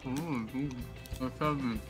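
mouth full. Real, not acted. Mouth stuffed with marshmallows.

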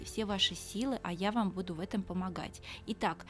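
ordinary talk, nothing marked

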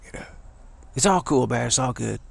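ordinary delivery

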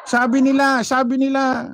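mocking singsong. Vlogger mocking the rally speakers' claims, high mocking singsong. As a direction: parrot them with a mocking lilt.

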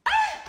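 very high shriek; shrill scream. Acted. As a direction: scream out in alarm. Shriek during the fight.

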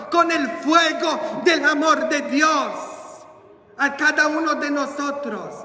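high, impassioned sermon voice; rising preacher's cry for the fire of God; fiery preaching. Acted. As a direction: preach it with burning fervor. Preaching at full force.

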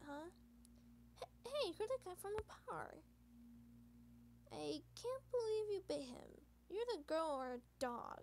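usual speaking voice

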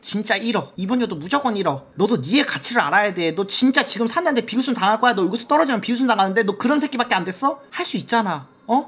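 low, hushed big-brother lecture; mock-stern pep talk. Big brother lecturing Bitcoin on its worth, mock-stern. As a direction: drop the voice low and talk it round earnestly.

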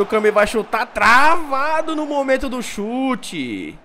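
lively football commentator calling the action; play-by-play commentary. Acted. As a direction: call the match like a TV announcer. Live match commentary in game.

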